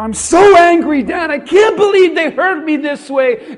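loud, high angry cry; angry outburst to God. Acted. Believer crying out to God, loud and angry. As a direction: let it burst out in hurt. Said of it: "imagine you're telling God".

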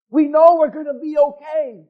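a full-voiced shout of assurance; preaching shout. Real, not acted. Preacher to congregation, shouting assurance. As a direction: proclaim it loud to the church. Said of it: "preacher shouting assurance".

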